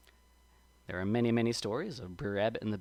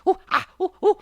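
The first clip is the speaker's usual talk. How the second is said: short high ooh and ah yelps; pricked yelps; 11 dB louder, faster than usual. A narrator's reading. Brer Rabbit lands among briars, high pained yelps. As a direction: yelp as if poked by thorns. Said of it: "he's getting poked and pricked".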